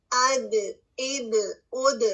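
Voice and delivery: slow اد with the d echoing; bouncing dal. Shown as a demonstration. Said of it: "آواز bounce back ہو رہی ہے".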